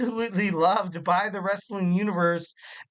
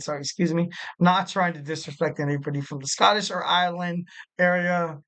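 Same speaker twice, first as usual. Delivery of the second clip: ordinary delivery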